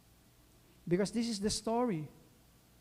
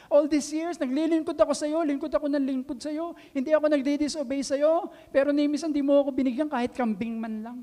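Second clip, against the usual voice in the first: high, loud, bitter grievance poured out; the older son pleading his case, indignant and hurt; resentful complaint; 8 semitones higher, 7 dB louder than usual. Acted. Older son to his father, complaining bitterly and loudly. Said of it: "Nagrereklamo siya".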